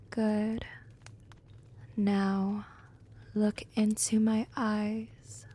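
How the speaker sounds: slow, soft hypnotist's voice with long pauses; hypnotic trance induction. Acted. Vampire putting a werewolf into a trance, slow and hypnotic. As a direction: lull them under, slowly and gently.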